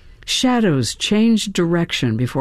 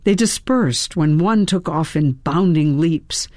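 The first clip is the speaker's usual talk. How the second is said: ordinary delivery, nothing marked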